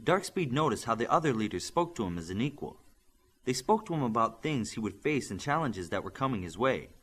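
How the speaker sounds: usual speaking voice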